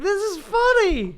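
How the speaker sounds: high, slow mock squeal; high-pitched retort. Comic retelling his security pat-down, high put-on squeal. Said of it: "And I was like".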